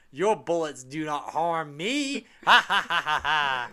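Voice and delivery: slow, hammed-up villain line into big laugh; mock vampire boast with staccato ha-ha laugh; imitated vampire yell. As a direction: declaim it slowly, then cackle loudly. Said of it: "the vampires yell the im-, immortal line".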